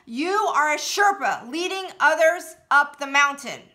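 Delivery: full-voiced shout from the gut; bellowed affirmations; loud yell. Shown as a demonstration. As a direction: yell it out with all your power. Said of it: "raise my voice and say this very loudly".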